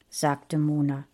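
usual speaking voice

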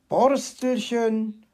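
slow, drawn-out name in surprise; startled. A narrator's reading. Fox discovering the little hedgehog behind him, startled. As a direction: swing round, caught off guard. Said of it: "fuhr Herr Fuchs herum".

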